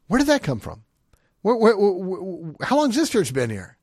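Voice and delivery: high, loud disbelieving questions; where where where, stunned; astonished. Retold or imitated. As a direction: blurt it out in shock. Retold astonished reaction.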